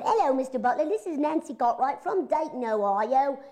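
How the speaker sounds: put-on a little cockney accent. Young Nancy leaves Dawes Butler a message in cockney. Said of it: "I just did a little cockney thing".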